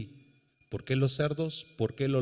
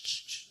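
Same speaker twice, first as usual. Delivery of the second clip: quick repeated soft shh; imitated shushing; 4 dB quieter than usual. Asking why parents hush babies, imitated shushing. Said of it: "¿Para qué le hacen shh".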